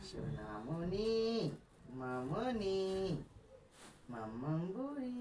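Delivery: slow, drawn-out singsong pet names. Real, not acted. Parent calling baby by pet names, cooing. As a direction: coo each name with a long rising glide.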